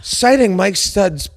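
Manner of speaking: mocking. Real, not acted. Mocking the podcast citation.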